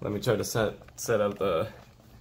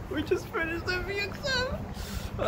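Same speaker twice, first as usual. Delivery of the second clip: high, slow excited squeal. Real, not acted. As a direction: stretch it out, thrilled and squeaky. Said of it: "Guys, we just finished the F E exam".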